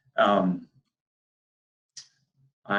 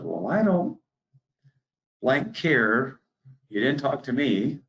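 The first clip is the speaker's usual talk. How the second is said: angry farmer; 7 semitones higher, slower than usual. Retold or imitated. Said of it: "He goes".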